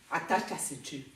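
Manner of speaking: low, soft warning; quiet warning. Real, not acted. Warning a rival before court, quiet and restrained. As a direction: say it low and under your breath.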